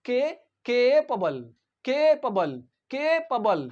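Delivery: capable as "KAY-puh-bul", middle vowel very short; long stressed KAY in capable, quick schwa after; short second A. Shown as a demonstration. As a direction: teach capable, weakening the middle A. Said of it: "इसमें very short पढ़ेंगे".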